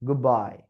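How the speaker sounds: "Goodbye" with a put-on foreign accent; faked accent goodbye. Shown as a demonstration. Teacher mocking a fake accent to examiners. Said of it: "ഗുഡ് ബൈ എന്ന് പറയുമ്പോൾ".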